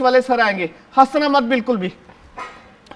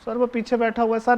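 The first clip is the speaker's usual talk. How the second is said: usual speaking voice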